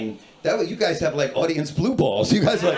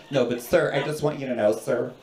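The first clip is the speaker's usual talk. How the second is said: softly insistent, patronizing interruption; a touch of polite condescension; condescending woman; 5 semitones lower, 5 dB quieter than usual. Retold or imitated. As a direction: mimic a nagging stranger in line. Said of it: "more condescending than sir".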